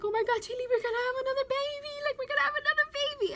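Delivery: high, fast, excited squeal; breathless rush of joy, very high; thrilled announcement. Retold or imitated. Retold thrilled news to daughter.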